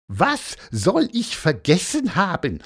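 high, indignant challenge; put-on squeaky voice, affronted; piqued question. A narrator's reading. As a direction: demand an answer in a high character voice. Annoyed bird challenges his friend.

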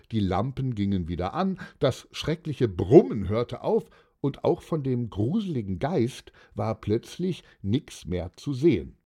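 ordinary delivery, nothing marked

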